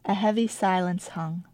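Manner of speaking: plain reading voice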